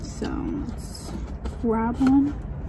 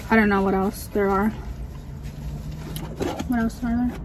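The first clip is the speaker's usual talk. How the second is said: ordinary delivery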